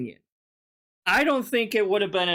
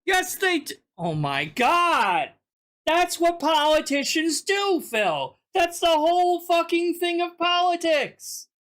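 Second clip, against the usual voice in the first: exasperated outburst; 10 semitones higher than usual. Real, not acted. Cohost loses patience with Phil, high exasperated outburst. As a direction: throw up your hands at Phil's naivety.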